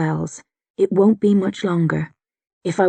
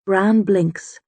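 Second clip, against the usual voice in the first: plain reading voice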